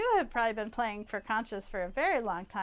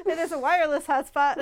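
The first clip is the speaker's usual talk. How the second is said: laughing; 6 semitones higher, 11 dB louder than usual. Real, not acted. Host laughing at own joke.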